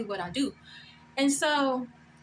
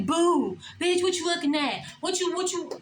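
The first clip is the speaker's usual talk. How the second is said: higher, pushy mock taunt; mock rowdy challenger; 4 semitones higher than usual. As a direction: play the loudmouth picking a fight. Imagined rowdy person picking fight.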